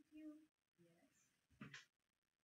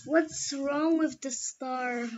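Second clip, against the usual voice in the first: usual speaking voice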